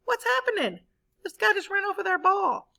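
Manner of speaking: very high, bewildered outburst; teacher voicing confused David, very high. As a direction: sound baffled and a bit indignant.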